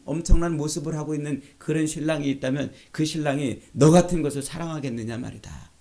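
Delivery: ordinary delivery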